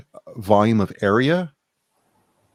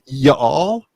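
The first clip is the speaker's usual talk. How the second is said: southern y'all. Shown as a demonstration. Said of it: "And then there's".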